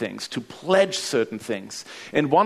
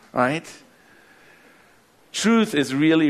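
ordinary talk, nothing marked

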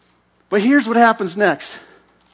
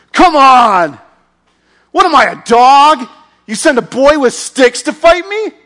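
loud, high giant's taunt; mocking roar; 7 semitones higher, 9 dB louder than usual. Goliath taunting little David, loud mocking roar. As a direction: bellow the insult down at the boy.

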